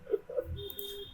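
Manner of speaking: a sudden high-pitched giggle, quickly stifled. Real, not acted. Streamer cracking up at her own dark joke, high giggle. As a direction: burst into a squeaky laugh, then hush it.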